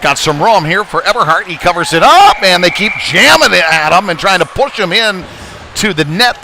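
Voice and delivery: rising urgency while they jam at the goalie; excited call. Real, not acted.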